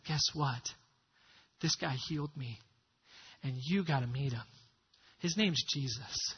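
low, soft, confiding voice; hushed confiding. Acted. One leper telling another about Jesus, hushed. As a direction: share the news quietly, like a secret.

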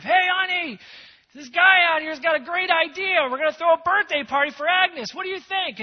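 loud, high excited call to the back; yelling to his wife. Retold or imitated. The big Samoan man calls back to his wife, excited. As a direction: call out to the kitchen, thrilled. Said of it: "he yells back to his wife".

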